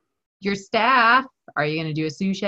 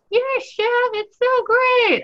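mock student voice. Mock student reply, very high.

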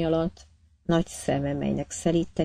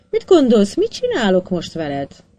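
louder, higher, losing patience; angry demand; 4 semitones higher, 6 dB louder than usual. A narrator's reading. Captain threatening the silent prisoner, angrily raised. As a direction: push hard, a captain's threat.

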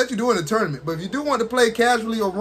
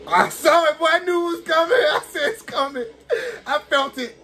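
high, half-crying laughter; laughing despair; 9 semitones higher than usual. Real, not acted. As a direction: laugh at your own doom. Laughing in dismay after getting hit.

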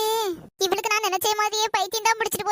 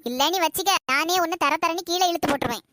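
normal talking voice

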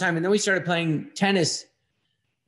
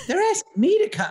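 pitched way up, protesting his innocence; indignant protest. Retold or imitated. Telling the host he was invited, high indignant protest. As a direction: insist you were invited, voice jumping high. Defending himself, retold protest.

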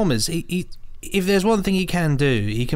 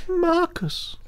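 high, far-off call of a name; high mock call. Podcasters mimic the film's eerie call. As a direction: cry out the name like a ghost.

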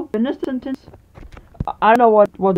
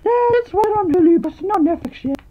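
squeaky toy voice; 7 semitones higher, 3 dB quieter than usual. Acted. High voiced toy character.

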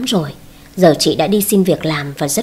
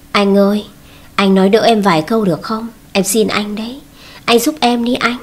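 plain reading voice

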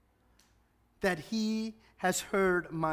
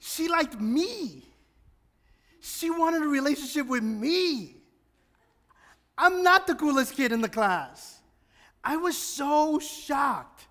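amazed; 8 semitones higher, 4 dB louder than usual. Real, not acted. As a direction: relive the thrill of being picked, pitched up. Retelling being chosen, amazed.

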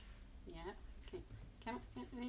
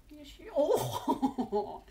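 laughing; 6 semitones higher, 9 dB louder, much slower than usual. Real, not acted. Cat grabs at the treat.

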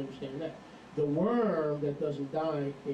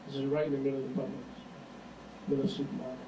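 normal talking voice

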